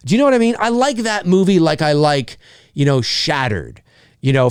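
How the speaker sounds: ordinary talk, nothing marked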